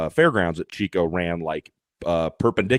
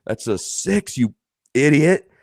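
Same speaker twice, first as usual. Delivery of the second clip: slow, louder deadpan "you idiot"; exasperated director; 7 semitones higher, 4 dB louder, slower than usual. Retold or imitated. Director scolding the announcer through the earpiece, exasperated. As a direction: drop it like a fed-up boss in the earpiece. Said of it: "Tyler McKim goes".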